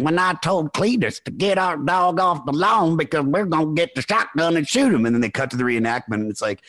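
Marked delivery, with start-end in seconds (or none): 0.0-5.1 Southern woman interviewee. Retold or imitated. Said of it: "she's like".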